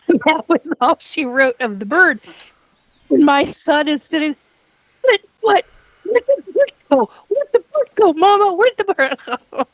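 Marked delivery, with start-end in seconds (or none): 5.0-9.7 put-on high, pleading child voice asking mama; imitating her bewildered son, pitched up; confused little boy. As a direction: mom voicing her toddler asking where the bird went.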